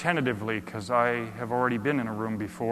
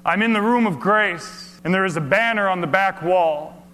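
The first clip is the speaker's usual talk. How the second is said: uplifted preaching; 8 semitones higher, 8 dB louder than usual. Acted. Preacher arriving in the room of grace, uplifted. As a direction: announce arrival with relief and joy.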